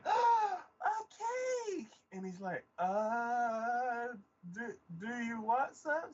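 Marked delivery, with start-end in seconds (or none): 0.1-1.9 loud, very high gasp of delight; excited little girl. Retold or imitated. Little girl seeing the cake, loud delighted squeal. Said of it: "this tiny little girl". 2.8-6.1 high, halting, stammered offer; flustered guard. Retold or imitated. Guard offering the girl cake, flustered and halting.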